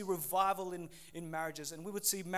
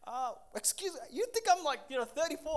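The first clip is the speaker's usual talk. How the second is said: a hint of the wife, higher and indignant; lightly voiced offended wife; 5 semitones higher, faster than usual. Preacher retelling his wife's mild indignation at her age. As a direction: play the wife, mildly put out.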